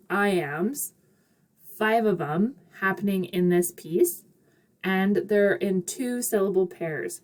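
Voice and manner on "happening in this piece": ordinary delivery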